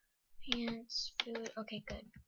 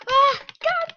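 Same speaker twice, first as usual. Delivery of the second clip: startled scream; 20 dB louder than usual. Real, not acted. Sudden attack in game.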